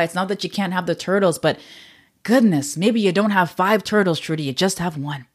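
ordinary talk, nothing marked

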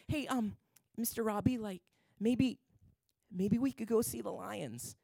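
put-on soft, coaxing little girl asking; sweet little girl. Sydney voiced asking sweetly.

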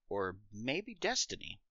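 slow, hushed and ominous; dramatic hush. Acted. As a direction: let it hang, soft and slow. Dramatic aside.

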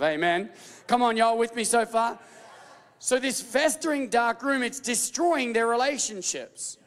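ordinary talk

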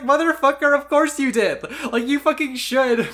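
high, loud exasperated outburst; loud mock outrage at a smug character; exasperated yell. Real, not acted. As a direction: yell it at the screen in disbelief.

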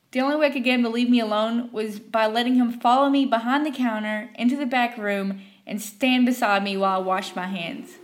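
plain reading voice

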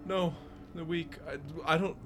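high voice rising to a loud wail; confused prisoner losing track of time; distraught old man. Acted. Old man answers how often it happens, distraught. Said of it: "you hear the old man go".

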